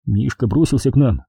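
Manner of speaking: usual speaking voice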